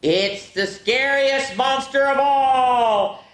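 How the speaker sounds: gasping mock fright shouting to the kids; playfully scare the class with a big fake gasp. Shown as a demonstration. Storyteller startles the kids at the final page with mock horror. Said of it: "I stand up and I go".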